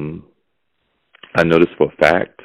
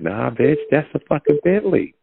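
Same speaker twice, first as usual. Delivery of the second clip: high-pitched acted indignation; mock getting mad; 11 semitones higher than usual. Friend arguing over the car, mock mad. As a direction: play mad, voice climbing high without shouting. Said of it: "I start getting mad".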